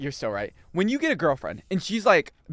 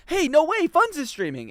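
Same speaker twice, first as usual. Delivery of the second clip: high mock-excited exclamation; excited announcement; 11 semitones higher than usual. Streamer retelling his excitement to chat, mock excited. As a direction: retell it as thrilled news, pitched up. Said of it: "I even said".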